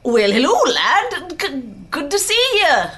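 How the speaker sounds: loud, high, hammed-up Scottish greeting; bad Scottish accent. Shown as a demonstration. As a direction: do a badly faked Scottish accent. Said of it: "S-, Scottish".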